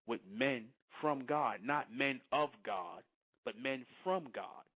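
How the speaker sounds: slow, each "from" leaned on against "of"; slow, deliberate contrast of "from" and "of"; stress on FROM. Shown as a demonstration. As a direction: hit "from" hard, say "of" lightly. Contrasting from versus of.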